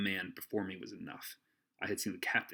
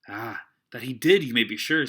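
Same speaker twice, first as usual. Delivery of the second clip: put-on eager boy; 2 semitones higher, 12 dB louder than usual. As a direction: agree eagerly. Jim agrees.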